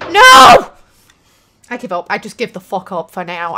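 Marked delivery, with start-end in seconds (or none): 0.1-0.7 high, loud scream of no; anguished shout. Real, not acted. Gamer falls once more, anguished shout. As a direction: yell it out in despair.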